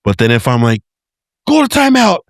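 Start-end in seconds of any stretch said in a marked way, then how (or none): 1.5-2.2 put-on high-pitched call to send a child off; hammed-up scolding dad, pitched way up; mock parental order. Acts out ordering child to timeout.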